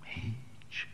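breathy hush of a single word, voice dropped away; whispered answer. Acted. Poet answering his own question in a resigned whisper. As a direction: let the word fall to a bare whisper.

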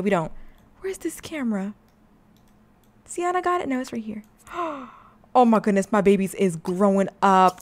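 usual speaking voice

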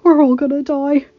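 loud, shaking terrified cry; panicked screaming. A narrator's reading. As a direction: scream it like death is coming. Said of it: "shaking violently from his edgy nerves".

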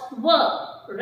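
W said as "wuh"; letter sound of W. Shown as a demonstration. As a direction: give W as a sound, not its letter name. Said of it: "what the W sounds?". (W ಅನ್ನು "ವ" ಎಂದು ಉಚ್ಚರಿಸಿ)

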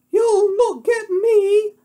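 very high, loud, slow falsetto; piggy cry. Acted. Pig defies the wolf from inside, high loud cry. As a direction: defy the wolf in a squeaky pig voice. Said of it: "the piggy cried".

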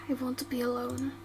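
soft, low and closed off; withdrawn. Acted. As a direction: push her away quietly. Injured, withdrawn.

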